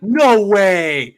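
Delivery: high, loud reenacted amazement; loud mock-stunned outburst; retold disbelief. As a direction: exclaim it like you can't believe it. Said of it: "and I'm like".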